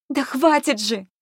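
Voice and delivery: high, slow, strained cry of enough; high-pitched angry protest; exasperated outburst. A narrator's reading. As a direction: cry out to stop the quarrel. Friend cuts into the quarrel.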